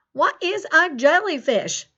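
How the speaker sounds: put-on folksy rural American farmer accent; Farmer Ted's country accent. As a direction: chat to the kids in a hammed-up country farmer accent. Plays Farmer Ted throughout lesson.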